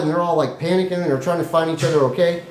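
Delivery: usual speaking voice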